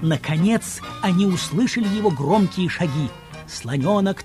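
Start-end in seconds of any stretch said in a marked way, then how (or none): none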